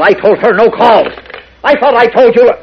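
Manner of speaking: loud, high-pitched irritated shout; exasperated outburst. Acted. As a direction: snap angrily at the interruption. Mayor snaps at interrupting phone call.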